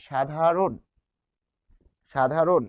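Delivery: "সাধারণ" as shadharon, stopping on n; demonstrated pronunciation. Shown as a demonstration. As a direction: model "সাধারণ" with no final vowel. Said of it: "যেহেতু আমরা থেমে যাই". ("সাধারণ" শেষে থেমে, শাধারোন)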